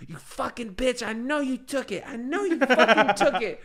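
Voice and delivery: hammed-up furious girlfriend voice; imitating cursing. Retelling the girlfriend cursing at the cat, in a high, hammed-up angry voice. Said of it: "I'm hearing Misty cursing".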